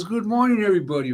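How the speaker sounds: high, hearty good morning to all; big friendly greeting. Retold or imitated. Star greeting the crew, high and hearty. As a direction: greet the whole set with a lift. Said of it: "He goes".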